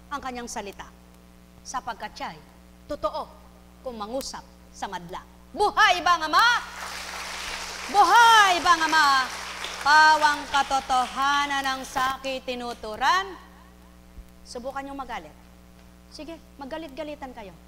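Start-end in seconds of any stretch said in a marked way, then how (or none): 5.5-13.4 preaching at full force. Real, not acted. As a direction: proclaim it to the whole congregation. Call and response chant. (malakas na pangangaral)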